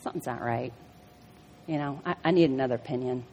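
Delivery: inner thought. Retold or imitated. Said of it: "I'm thinking".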